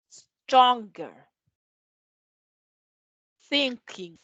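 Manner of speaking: "Stronger" and "Thinking" with a back ng, "Stronger" loud; ng sound demo. Shown as a demonstration. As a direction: model the ng words clearly. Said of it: "Esa N se pronuncia acá".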